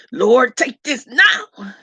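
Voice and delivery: slow, emphatic plea, pitch pushed up; fighting it off. Acted. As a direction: plead as if fighting something off. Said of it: "you might be fighting it off".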